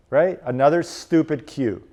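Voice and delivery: ordinary talk, nothing marked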